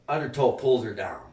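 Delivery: ordinary talk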